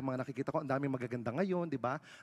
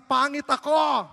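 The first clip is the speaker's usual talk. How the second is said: high, slow shouted line; slow loud prompt; 9 semitones higher, 11 dB louder, much slower than usual. Pretend. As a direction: drag it out loud for laughs. Prompting crowd's answer.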